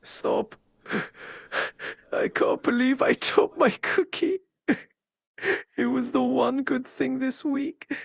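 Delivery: fake sobbing. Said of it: "Do that again".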